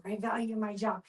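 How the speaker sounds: normal talking voice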